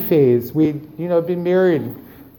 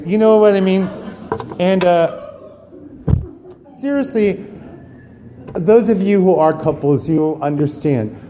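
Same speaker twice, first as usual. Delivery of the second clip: normal talking voice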